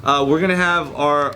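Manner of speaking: ordinary talk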